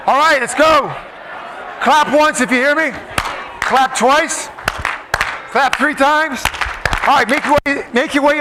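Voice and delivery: calling out. Real, not acted. Calling the crowd to attention.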